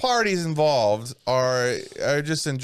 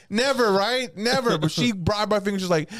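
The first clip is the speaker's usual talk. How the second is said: ordinary delivery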